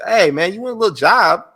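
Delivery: lightly voiced schmoozing manager offering a job; Paul Heyman impression. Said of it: "Paul Heyman was like".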